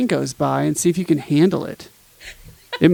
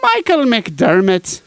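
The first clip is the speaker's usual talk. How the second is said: slow, drawn-out name in a hammed-up Irish lilt; mock Irish accent; 3 semitones higher, 3 dB louder, slower than usual. Host teasing a colleague's surname in a bad Irish accent. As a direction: roll the name out like a stage Irishman. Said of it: "my Irish accent is horrible".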